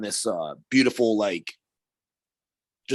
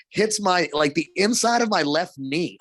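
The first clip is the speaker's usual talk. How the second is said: ordinary delivery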